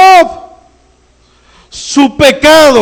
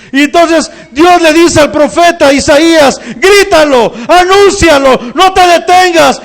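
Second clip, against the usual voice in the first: fiery preaching; 5 semitones higher than usual. Acted. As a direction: proclaim it like a trumpet call to the church. Preaching at full force.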